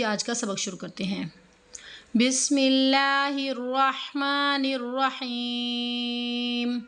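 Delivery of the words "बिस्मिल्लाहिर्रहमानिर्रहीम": slow, drawn-out Quran recitation. Shown as a demonstration. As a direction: recite each syllable long and melodic. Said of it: "Quran recitation lesson". (धीमी तिलावत)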